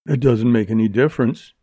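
usual speaking voice

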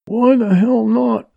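high, loud, slow challenge; indignant roar. A narrator's reading. Man outside refused entry at the door, roaring. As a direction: roar it out, stretching each word. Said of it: "roared one of them".

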